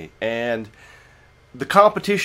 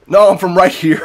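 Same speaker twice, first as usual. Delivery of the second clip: loud, higher-pitched mock Irish; fake Irish accent; 5 semitones higher, 9 dB louder than usual. Tipsy customer answering the bar owners in fake Irish accent. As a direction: insist in a corny Irish accent. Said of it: "total fake ass Irish accent".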